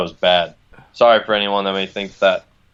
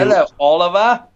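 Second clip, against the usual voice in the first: mock British; 8 semitones higher, 4 dB louder than usual. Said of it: "replying as a British character".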